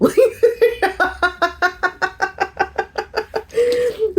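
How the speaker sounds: long high-pitched laughter; giddy high laughing. Real, not acted. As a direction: let the laugh run long. Laughing at herself.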